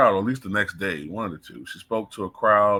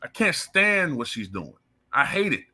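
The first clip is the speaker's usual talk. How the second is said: ordinary delivery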